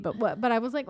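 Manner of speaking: ordinary delivery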